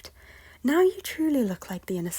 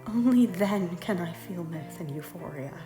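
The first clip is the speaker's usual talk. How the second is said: impassioned plea; 3 semitones higher, 8 dB louder than usual. Acted. Lady telling her lord what would end her suffering, loud and insistent. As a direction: drive each demand home forcefully. Lady's climactic plea in quarrel.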